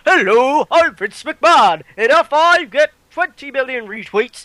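mock wrestling boss on the phone; loud, hammed-up boss impression; Vince McMahon. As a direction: put on a big, silly tycoon voice. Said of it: "I can't imagine Vince McMahon going".

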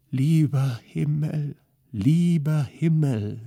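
high, slow, moaned lieber Himmel; slow despairing moan. A narrator's reading. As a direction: groan it in despair. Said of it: "stöhnte Polixeni".

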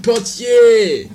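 loud drawn-out call across the room; loud slow summons. Pretend. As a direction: call the porter back, stretching the word. Calling the porter back.

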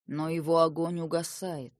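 медленно и спокойно, без волнения; говорить бесстрастно, как отшельник; невозмутимый старик. A narrator's reading. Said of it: "невозмутимо сказал старик". (невозмутимый голос старика)

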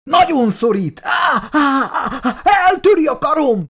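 high-pitched screaming with cries of pain; screeching. A narrator's reading. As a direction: screech as if the arm is breaking. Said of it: "visította Milburn".

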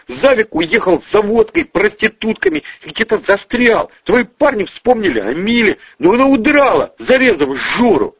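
high-pitched, anxious report; flustered explaining. Acted. Bonifatsky accounts for the escape, high and flustered. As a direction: pour out your excuse.

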